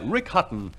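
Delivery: ordinary talk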